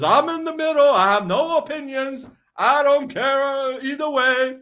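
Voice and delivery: high-pitched, loud mocking impression; Russell Brand. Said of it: "that Russell Brand guy says".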